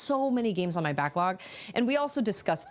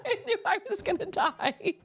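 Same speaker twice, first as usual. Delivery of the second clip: high, laughing through the words. Real, not acted. Streamer laughing after dying in the level, high laughter. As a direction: crack up at your own failure. Laughs at her own death.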